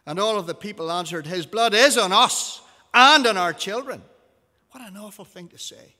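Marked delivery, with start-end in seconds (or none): 1.3-4.0 very loud, high-pitched declaration; crowd's loud cry. A narrator's reading. All of the people answer Pilate, loud high cry. 4.7-6.0 soft, higher-pitched comment; hushed aside. Real, not acted. Reader reacts to the crowd's answer, hushed aside.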